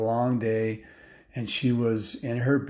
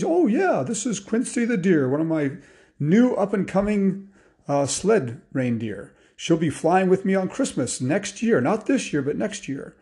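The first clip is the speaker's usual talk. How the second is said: animated, higher-pitched hearty Santa; jolly old Santa; 6 semitones higher, 4 dB louder than usual. Acted. Santa shows off his new sled reindeer, jolly. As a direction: boast merrily as Santa. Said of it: "jolly old Santa".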